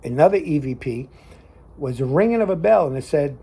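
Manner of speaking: ordinary talk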